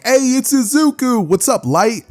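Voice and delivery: high, drawn-out hail; excited greeting. Acted. Classmates greeting Deku as he blitzes in, excited. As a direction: call out to a popular classmate.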